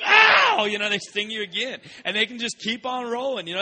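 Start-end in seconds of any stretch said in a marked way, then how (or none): 0.0-0.7 loud, high yelp of pain. Pretend. Preacher acting a fresh sting, loud high yelp. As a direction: cry out as the sting hits. Stung again, pained yelp.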